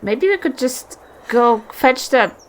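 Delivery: ordinary talk, nothing marked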